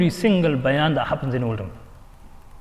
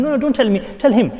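annoyed retort; 6 semitones higher than usual. Retold or imitated. Telling the father at the madrasa, exasperated and higher. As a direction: push the job back at him.

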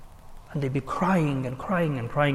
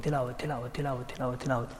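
very soft, low repeated murmur; murmured recitation; 3 semitones lower, 12 dB quieter, faster than usual. Retold or imitated. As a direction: keep reciting under your breath. Imitates constant recitation while walking.

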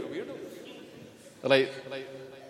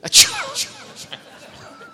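loud high laugh; 5 dB louder than usual. Real, not acted. Laughs at the audience's answer.

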